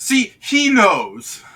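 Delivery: high, loud, slow orc exclaim. Acted. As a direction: draw it out as a pleased orc. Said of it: "the orc goes".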